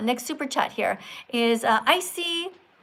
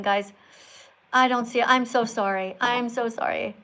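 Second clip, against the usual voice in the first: ordinary talk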